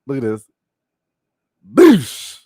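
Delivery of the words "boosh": loud, high "boosh" on the hit; sudden yelled smack sound; explosive impact noise. Real, not acted. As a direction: shout a sound effect for the blow. Mimics hit in slow-motion replay.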